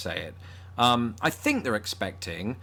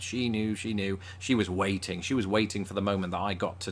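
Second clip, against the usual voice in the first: ordinary talk, nothing marked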